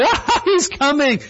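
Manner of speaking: high, giddy acted squeal with laughter; unbridled expectation. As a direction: burst out in overjoyed anticipation. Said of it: "with, like, unbridled expectation".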